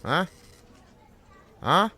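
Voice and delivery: normal talking voice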